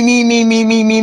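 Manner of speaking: usual speaking voice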